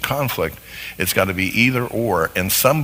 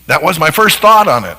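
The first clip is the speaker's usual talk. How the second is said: louder and higher mock protest, digging in; stubborn insistence; 6 semitones higher, 10 dB louder than usual. Spouse defending their first idea in a hammed-up, louder, higher voice. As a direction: play the spouse who won't budge off a first idea.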